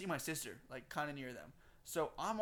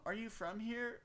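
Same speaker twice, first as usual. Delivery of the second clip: lightly voiced girl, pitched higher; imitated girls' question; 7 semitones higher, slower than usual. Girls asking the narrator where he's from, light higher mock voice. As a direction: ask it in a slightly put-on girly voice.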